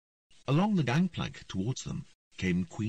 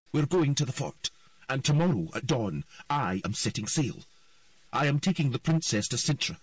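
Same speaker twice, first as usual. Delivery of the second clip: stern commanding; 4 semitones higher than usual. A narrator's reading. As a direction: lay down the law coldly. Queen commands earl.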